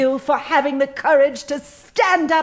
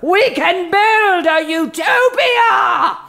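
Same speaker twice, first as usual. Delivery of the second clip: loud, high, slow rallying shout; rousing cry; 5 semitones higher, 4 dB louder, slower than usual. Acted. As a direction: proclaim it like a grand vision. Boss rallies employee excitedly.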